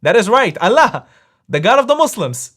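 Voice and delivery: ordinary talk, nothing marked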